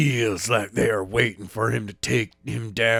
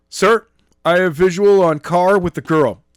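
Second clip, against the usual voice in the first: soldier report; 6 semitones higher, 8 dB louder than usual. Acted.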